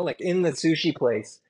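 usual speaking voice

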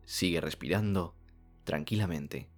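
normal talking voice

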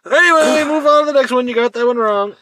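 high, very loud, cutting the topic off; loud impatient shout. Real, not acted. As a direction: holler to hurry the game along. Shouts to move game along.

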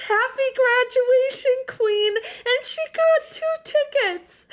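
high, slow, emotional and loud; overjoyed. Real, not acted. Reading her friend's text aloud, overjoyed and tearful. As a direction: stretch it out, overcome with joy.

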